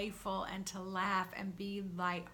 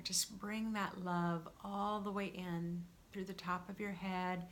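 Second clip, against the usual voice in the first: guided meditation; 4 dB quieter than usual. Real, not acted. Guide inviting listeners to feel their soul's love, calm meditation voice. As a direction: let each phrase settle, with long pauses.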